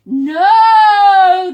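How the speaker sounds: loud high no; crowd yell. Acted. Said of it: "the crowd yelled".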